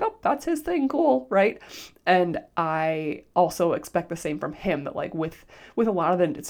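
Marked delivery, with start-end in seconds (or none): 0.0-1.3 breezy acceptance. Retold or imitated. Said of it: "I can be like".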